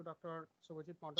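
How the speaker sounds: faint, distant mic voice; hardly heard over the line; barely audible. Real, not acted. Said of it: "your voice is very low".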